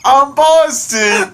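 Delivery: loud, high, drawn-out Boston parody; exaggerated slow Boston accent; mock Boston accent. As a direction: echo a silly Boston caricature. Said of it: "So light on an accent".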